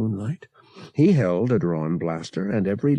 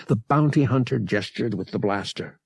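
plain reading voice

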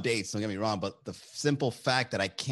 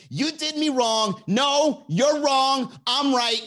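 loud, high, heated rant at a partner; mock furious tantrum, voice cracking high; angry outburst; 13 semitones higher, 5 dB louder, slower than usual. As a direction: blow up and lose your cool. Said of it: "But if I'm angry".

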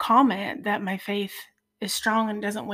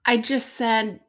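ordinary delivery

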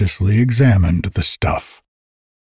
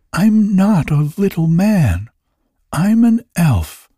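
higher-pitched, lightly voiced little elf; dry-voiced elf; 9 semitones higher than usual. A narrator's reading. Elf correcting Toinette about being a man, dry and higher. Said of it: "responded the dry voice".

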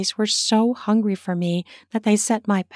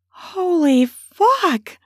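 high, drawn-out stunned exclamation; muttered shock; 10 semitones higher, 5 dB louder, much slower than usual. A narrator's reading. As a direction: swear in loud, slow astonishment. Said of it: "I muttered".

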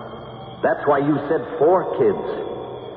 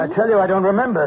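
a raised, insistent voice; shouting; 8 semitones higher, faster than usual. Acted. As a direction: push back at her, half shouting. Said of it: "you're shouting at me".

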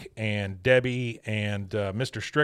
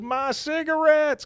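much higher, slow lament; mournful wail; 3 dB louder, slower than usual. Retold or imitated. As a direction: stretch it out in grief. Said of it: "He goes".

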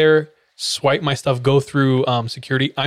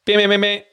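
high mock beeping of a ticket scanner; scanner beep imitation; 8 semitones higher, faster than usual. Mimicking the gate scanner rejecting his ticket, high beeps.

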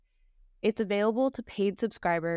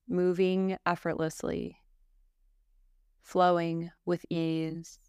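calm, even yoga nidra guidance with long pauses; guided meditation; 2 semitones lower than usual. Acted. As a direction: guide a listener gently into rest, phrase by phrase.

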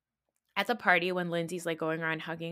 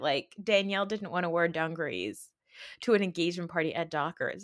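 ordinary talk

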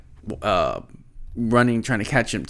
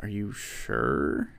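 low, slow and soft; low doubtful question; 8 semitones lower, 8 dB quieter, much slower than usual. Retold or imitated. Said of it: "And they're like".

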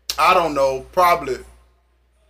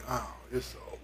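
much softer and lower, giving up; hushed despair; 9 semitones lower, 21 dB quieter than usual. Retold or imitated. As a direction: breathe it out like all hope is gone. Sees blood under the door.

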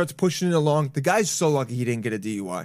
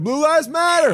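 mock cheer; 11 semitones higher, 6 dB louder than usual.

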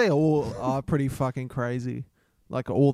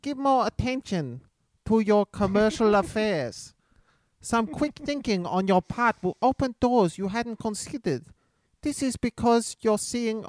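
high-pitched put-on accent; hammed-up comic fortune teller, pitched well up; Batsu character voice; 6 semitones higher than usual. Reading horoscopes to co-host as a recurring character, high comic voice. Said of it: "Could Batsu do some horoscopes?".

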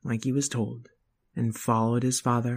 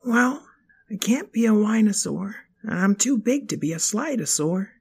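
put-on kid voice; 8 semitones higher than usual. Child voice.

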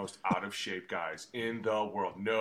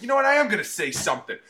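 fired-up declaration; 11 semitones higher, 10 dB louder, faster than usual. Retold or imitated. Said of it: "He's like".

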